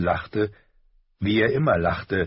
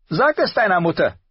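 higher, louder curt rebuke; Tyrion rebuking; 12 semitones higher, 6 dB louder than usual. A narrator's reading. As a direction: put him in his place. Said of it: "erklärte Tyrion".

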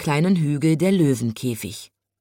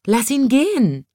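high, raised wildcat's cry; sudden shout; 8 semitones higher, 4 dB louder than usual. A narrator's reading. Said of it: "schrie plötzlich die bunte Wildkatze". (plötzlicher hoher Zuruf)

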